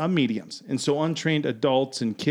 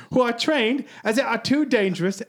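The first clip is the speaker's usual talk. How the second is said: a light mock British accent, pitched higher; 7 semitones higher than usual. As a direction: read the quote in a hammed-up British accent. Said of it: "I gotta use a British accent".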